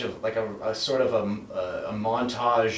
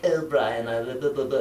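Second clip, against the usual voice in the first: Stewie Griffin impression; 3 semitones higher than usual. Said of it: "Stewie was like".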